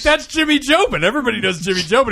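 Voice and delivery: high, loud, giggly insistence; as if everyone should know him; gushing. Retold or imitated. Laura explaining to Nick, high gushing insistence. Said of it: "she's like".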